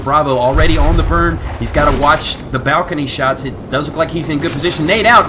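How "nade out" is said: sharp loud call of nade out; grenade warning yell. Real, not acted. Caster calls grenade throw.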